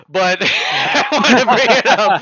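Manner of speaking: loud, laughing confession. Real, not acted. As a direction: laugh as you admit it. Laughing at a terrible movie.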